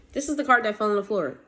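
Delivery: normal talking voice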